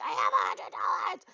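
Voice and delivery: loud, strained retold shout; growled-out yell of frustration; exasperated mock outburst. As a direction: shout it in comic desperation. Mock outburst to people asking.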